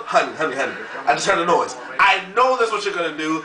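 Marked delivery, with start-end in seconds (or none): 0.1-1.8 low hushed mock-scared voice; scared wife voice. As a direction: lightly imitate a timid wife. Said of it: "You won't go".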